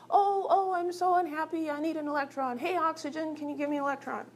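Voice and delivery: high-pitched, pleading little atom. Acted. Hydrogen begs oxygen for an electron, high whiny atom voice. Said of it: "my atom voice again".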